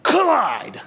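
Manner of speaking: Collide punched out high, from the gut; guttural deep-down shout. Shown as a demonstration. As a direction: throw out "Collide" as one hard, high gut-punch word. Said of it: "it's like".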